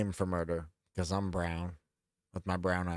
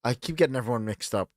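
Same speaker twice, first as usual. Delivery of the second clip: ordinary talk, nothing marked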